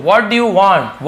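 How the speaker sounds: every word drawn out slowly; slow, clear teacher demo; slow model reading. Shown as a demonstration. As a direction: read it slowly for the class to copy. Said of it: "teacher models sentence slowly".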